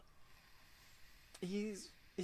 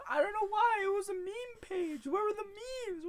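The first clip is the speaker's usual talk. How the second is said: hammed-up crybaby whine, much higher; mock whimpering complaint; 12 semitones higher, 8 dB louder than usual. Mock whining about meme page.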